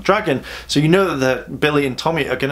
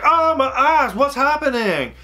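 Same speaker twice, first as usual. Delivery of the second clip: much higher, hammed-up startled cry; mock shock; 11 semitones higher, slower than usual. Rangers startled by the attacking Tyrannosaurus, mock high shock. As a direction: squeal it like a cartoon crowd. Everyone shocked in retold scene.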